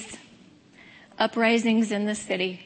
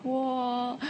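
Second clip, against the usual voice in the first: movie whoa; 2 semitones higher, 7 dB quieter than usual. Retold or imitated. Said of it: "in the movies where everything's like".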